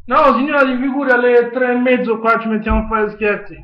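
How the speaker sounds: usual speaking voice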